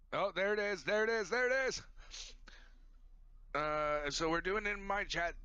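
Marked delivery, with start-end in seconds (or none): 0.1-1.8 excited. Real, not acted. Intro finally appears in chat.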